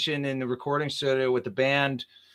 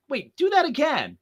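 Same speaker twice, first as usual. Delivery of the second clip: much higher, startled retold request; urgent surprise; 12 semitones higher than usual. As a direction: blurt it out as if struck by the sound.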